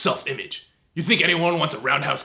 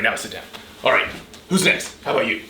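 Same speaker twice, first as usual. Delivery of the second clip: ordinary talk, nothing marked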